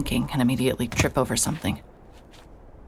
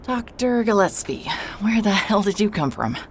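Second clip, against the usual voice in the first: breathless startled whisper, pitched up; 7 semitones higher than usual. Acted. Startled by the doctor behind her, a breathless whisper. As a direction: gasp it out in shock.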